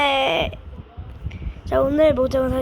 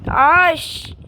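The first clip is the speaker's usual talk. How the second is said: loud annoyed curse; frustrated curse; 5 semitones higher, 7 dB louder, slower than usual. Real, not acted. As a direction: snap out a curse. Fails jump, curses.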